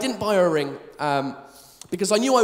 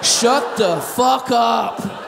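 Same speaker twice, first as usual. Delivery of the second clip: mock snap; 3 dB louder, much slower than usual. Snaps at imagined baby daughter.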